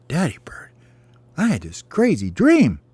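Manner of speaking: high-pitched little bird; high, childlike storybook voice; baby bird voice. Acted. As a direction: pipe up like an eager child to a parent. Child bird tells daddy its dream.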